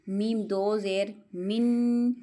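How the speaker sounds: मीम दो ज़बर मन spelled slowly, ending on a clear nasal मन; slow spelled-out demo. Shown as a demonstration. Teacher spells tanween for students, slowly. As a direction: spell each letter out slowly, landing on मन. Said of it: "वो इस तरह करें".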